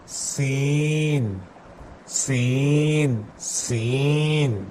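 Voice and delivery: سین drawn out slowly with a whistling s; whistling seen. Shown as a demonstration. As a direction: stretch the hiss of سین. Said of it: "سیٹی سی آواز نکلے گی". (سیٹی جیسی سین)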